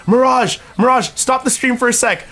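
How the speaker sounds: high, urgent calling out. Retold or imitated. Friends urging Mirage to stop his stream, high and urgent. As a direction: hurry him to stop the stream.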